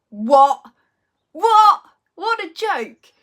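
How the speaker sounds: loud, high-pitched pretend disbelief; mock outrage. Joking to viewers about her birthday, with mock outrage. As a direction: play up the shock at your own age.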